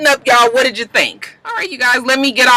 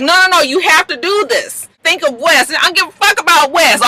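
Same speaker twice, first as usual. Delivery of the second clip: acted-out argument, higher pitched; mock pleading, pitched up and insistent; heated insistence; 8 semitones higher than usual. Acts out heated argument over Wes.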